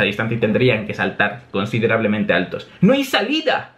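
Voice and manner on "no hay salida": high-pitched cry of alarm; acted yell. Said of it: "gritan ambos".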